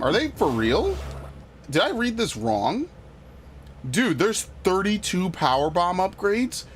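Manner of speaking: high, rising questions of disbelief; incredulous. Real, not acted. Player rereading the power bomb count, incredulous. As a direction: ask it as though you misread.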